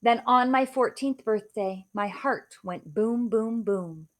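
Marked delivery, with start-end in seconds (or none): none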